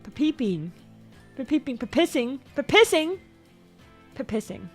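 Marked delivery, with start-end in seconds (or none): none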